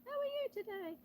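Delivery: high, soft put-on character voice; Penelope's voice. Shown as a demonstration. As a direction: pitch it way up, small and quiet, as a playful character. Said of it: "me doing voices for Penelope".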